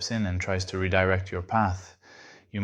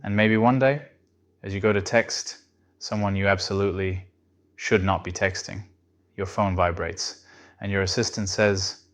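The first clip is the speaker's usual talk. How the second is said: ordinary delivery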